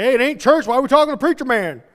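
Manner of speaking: loud, high mock husband voice; hammed-up baffled husband; incredulous husband. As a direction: question her loudly in disbelief. Acts husband objecting to preacher visit.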